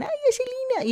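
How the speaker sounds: very high, sing-out imitation of fond relatives; mock affectionate call. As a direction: call the nickname in a put-on high voice. (imitando un llamado cariñoso, muy agudo)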